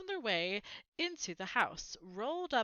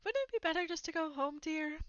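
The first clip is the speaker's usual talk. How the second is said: higher, quick and hesitant plea; timidly; 7 semitones higher than usual. Acted. Aunt Petunia to Uncle Vernon, timid suggestion. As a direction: ask nervously, afraid to upset him. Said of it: "Aunt Petunia suggested timidly".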